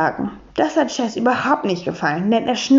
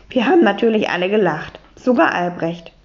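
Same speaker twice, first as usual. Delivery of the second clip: ordinary delivery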